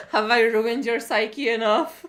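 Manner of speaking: loud question through laughter; cracking up while teasing; laughing. Real, not acted. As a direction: barely get it out between laughs.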